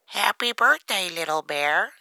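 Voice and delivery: high, loud duck character voice; high-pitched storybook duck. A narrator's reading. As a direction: call out a birthday greeting as a duck. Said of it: "says Duck".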